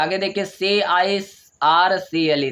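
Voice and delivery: normal talking voice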